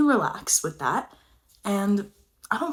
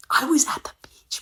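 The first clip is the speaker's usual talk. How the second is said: higher, airy whisper for emphasis; high dramatic whisper; 8 semitones higher than usual. Acted. Stressing to viewers she was at the beach, high whisper. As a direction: lift the whisper up in mock disbelief.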